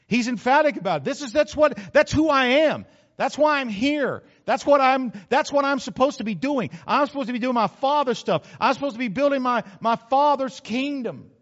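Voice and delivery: fervent sermon climax, loud and high; preaching at full force. Acted. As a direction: drive it home to the congregation. Preaching builds.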